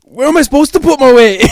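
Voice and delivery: put-on high, loud Scottish accent yell; mock Scottish accent. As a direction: shout it in a hammed-up Scottish voice. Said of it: "That's, like, Scottish".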